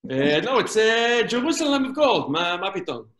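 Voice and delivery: put-on Israeli-accented counselor, halting and patient. Israeli counselor Yossi corrects a camper, in an Israeli accent. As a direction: answer the kid in an Israeli accent, hesitating. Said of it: "counselor Yossi answers".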